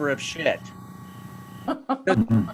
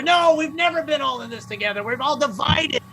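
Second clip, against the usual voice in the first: high-pitched exasperated protest; worked-up, high rant; indignant rebuttal; 9 semitones higher than usual. Acted. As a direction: argue back in comic outrage. Angry rebuttal of the slogan.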